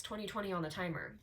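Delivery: normal talking voice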